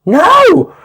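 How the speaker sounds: very high, loud petulant shriek; hammed-up tantrum shout; shrill no. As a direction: screech a bratty no. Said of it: "And he goes".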